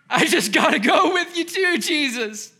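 high, loud, overexcited plea to tag along; overeager religious man. Retold or imitated. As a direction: play a breathless, eager zealot begging Jesus. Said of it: "someone riddled by decades of crippling religion saying".

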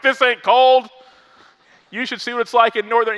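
high, loud mock brag; bragging Canadian. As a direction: shout the boast, hammed up. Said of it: "someone in Wisconsin that's from Canada is like".

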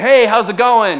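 high, loud acted hello; cheery phone greeting. Husband answering his wife's phone call, cheery greeting.

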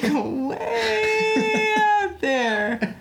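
long mock wail; stretched-out exaggeration. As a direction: stretch "way" as if drifting into the distance. Mimics drifting far off on tangent.

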